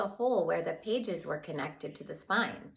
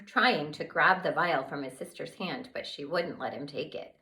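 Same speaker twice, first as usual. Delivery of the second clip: ordinary delivery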